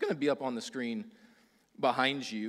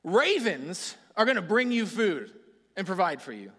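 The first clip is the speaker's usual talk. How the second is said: higher, louder booming divine voice; higher and louder, God giving a grand order; voicing God; 7 semitones higher, 7 dB louder than usual. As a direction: announce it like God calling from above. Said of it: "and so God says".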